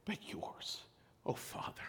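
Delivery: soft whispered surrender; whispered prayer. Acted. Jesus facing death, yielding to the Father in a whisper. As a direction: breathe the words out in a whisper. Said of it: "he said".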